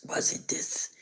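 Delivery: ordinary delivery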